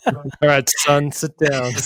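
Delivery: mock-fatherly, slowing right down; dad voice. Host jokingly sits his son down, dad voice. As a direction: play a hammed-up dad about to give advice. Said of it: "[name] just put on his dad voice".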